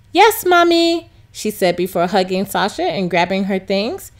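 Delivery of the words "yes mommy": put-on higher child's reply; lightly voiced little girl. A narrator's reading. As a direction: answer sweetly like a small girl. Daughter voiced.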